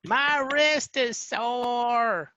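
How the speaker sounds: put-on high, slow, whiny teen voice; mock a kid who won't turn the phone; whiny young person impression. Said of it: "That was pretty good. That fit the accent".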